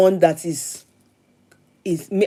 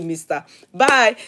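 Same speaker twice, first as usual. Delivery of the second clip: usual speaking voice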